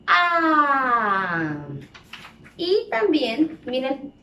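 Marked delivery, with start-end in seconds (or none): 0.1-1.6 una A larga y fuerte, muy abierta; vocal A sostenida. Shown as a demonstration. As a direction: estira la A a todo pulmón para que la repitan. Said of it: "vamos a repetir el sonido de la vocal A". (una "A" alargada y en voz alta, de maestra)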